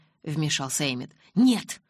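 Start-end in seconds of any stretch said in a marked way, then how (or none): 1.3-1.8 much higher, louder flat refusal; adamant no. A narrator's reading. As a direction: refuse outright. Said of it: "Эдвард был непреклонен". (непреклонное громкое «Нет!»)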